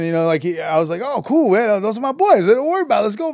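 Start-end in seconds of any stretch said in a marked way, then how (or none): 1.0-3.3 high-pitched chummy assurance; breezy enthusiasm. Retold or imitated. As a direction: wave off worry cheerfully, pitch up. Retold breezy reassurance.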